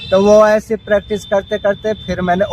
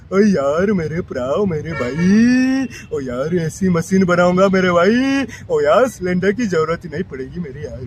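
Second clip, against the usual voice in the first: cartoon character voice. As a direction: drag it out like a lazy cartoon pal. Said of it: "Motu Patlu ... झलकियां".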